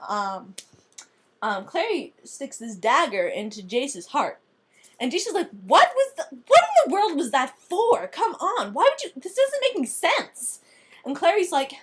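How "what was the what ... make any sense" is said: high, pained protest; indignant shock. Acted. Jace protesting after being stabbed, high indignant. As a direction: whine it out, stunned.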